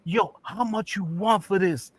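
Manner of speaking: high-pitched, slow amazed question; hammed-up awe, stretched out high; astounded. Astounded customer asks the price, amazed and high. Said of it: "The guy was astounded".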